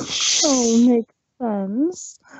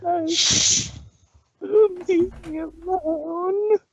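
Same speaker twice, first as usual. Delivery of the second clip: heartbroken; 10 semitones lower than usual. Acted. Pinky left alone on stage, heartbroken. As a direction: let her heart break. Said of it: "in a heartbroken voice".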